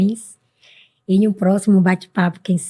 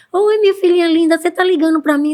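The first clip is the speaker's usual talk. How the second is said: high, louder, delighted imitation of mum; doting mother; 8 semitones higher, 4 dB louder, faster than usual. Mother answering her daughter's call, imitated with delighted affection.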